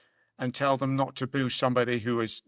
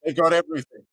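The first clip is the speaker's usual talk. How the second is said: normal talking voice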